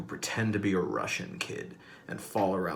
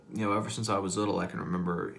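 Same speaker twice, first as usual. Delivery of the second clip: usual speaking voice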